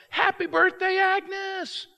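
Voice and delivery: high, loud surprise-party shout; crowd yell. Retold or imitated. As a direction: yell it out like a whole room. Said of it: "And they all yell".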